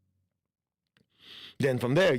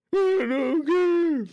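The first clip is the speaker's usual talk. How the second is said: put-on much higher, slow, louder whiny child; sulky child; 5 dB louder, much slower than usual. Child denied ice cream sulks back, high, slow and whiny. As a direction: drawl it out like a pouting kid.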